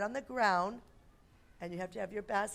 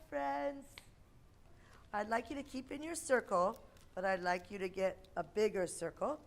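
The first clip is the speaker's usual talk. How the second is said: put-on bright, patient voice for small kids; kindergarten teacher voice; 2 semitones higher than usual. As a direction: explain a game to little children. Leads kids' game.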